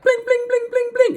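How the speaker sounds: very high sung harp plinks; harp strumming. Retold or imitated. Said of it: "he's like".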